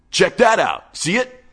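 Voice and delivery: high, loud excited call. Acted. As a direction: jab at the screen with glee. Wade excited over video.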